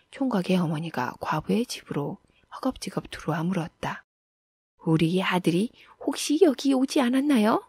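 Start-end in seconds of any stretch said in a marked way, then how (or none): 4.9-7.6 higher voice, rising question; mother anxiously asking after her son; inquiring mother. A narrator's reading.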